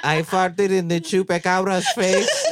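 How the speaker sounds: put-on high-pitched Mexican accent, breaking into laughter. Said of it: "I'm losing the accent".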